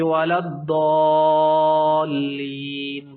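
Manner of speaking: الضالین chanted very slowly with the lām not doubled; wrong recitation demo. Shown as a demonstration. The teacher warns students off the wrong chant. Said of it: "مت کبھی آپ نے اختیار کرنا ہے".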